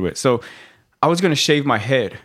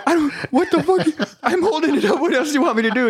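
high-pitched, laughing bewilderment; imitated confused recruit. Recruit holding it in wrong hand, high confused protest. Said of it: "he's confused".

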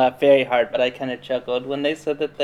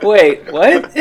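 louder, higher, with a laugh; baffled; 6 semitones higher, 10 dB louder than usual. Retold or imitated. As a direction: laugh in disbelief. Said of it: "And I was like".

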